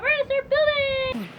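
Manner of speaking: eager, high and loud; excited. Pretend. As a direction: burst out with excitement. Excited pretend student.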